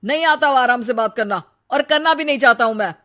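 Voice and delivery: fast, heated comeback from the husband; angry retort. Acted. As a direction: fire it back quickly, voice rising. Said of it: "बात नहीं कर सकते क्या आराम से". (गुस्से में तेज़ी से पलटकर जवाब)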